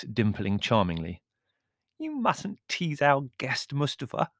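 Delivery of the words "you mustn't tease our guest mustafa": high, slow playful chiding; delighted, drawn-out musical scolding; girlish countess. A narrator's reading. Countess chiding servant over teasing guest, playful.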